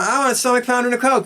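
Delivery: put-on high and quick, a child whining an order; demanding little kid. Son asking his mom for food, high fast kid voice. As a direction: rattle it off like a hungry boy.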